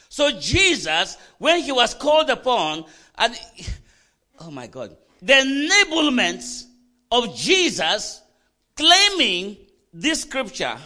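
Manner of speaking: slow, loud, high proclamation; loud, stretched-out preacher's cry; full-force preaching. Real, not acted. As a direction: hammer each phrase out to the congregation.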